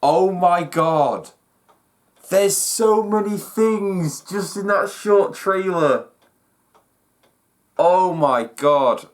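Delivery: slow, drawn-out amazement; awed. Real, not acted. Still reeling from the trailer, slow awed delivery. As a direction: savor each word slowly.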